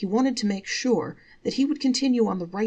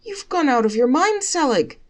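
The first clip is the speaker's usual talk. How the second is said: high, loud scolding wife; exasperated wife; 9 semitones higher, 8 dB louder than usual. Acted. Wife scolding Selig, high and loud. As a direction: scold him like a fed-up wife.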